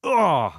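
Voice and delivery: loud, high fighter's exertion cry; demonstrated punch yell; punching grunt. Shown as a demonstration. Voice actor showing the noise made when punching, loud effort cry.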